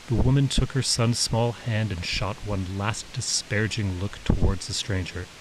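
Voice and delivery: ordinary delivery, nothing marked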